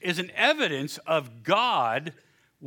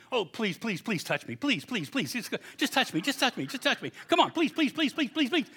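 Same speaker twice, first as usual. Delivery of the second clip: pleading, rising higher to a laughing plea; dog begging; 5 semitones higher than usual. Retold or imitated. Preacher voicing his dog Bruno begging to be touched, pleading. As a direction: beg like an eager dog. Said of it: "I can read his mind".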